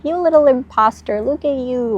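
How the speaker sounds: high, sneering mocking voice; inner critic voice. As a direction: taunt yourself like a bully. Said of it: "your mind will be like".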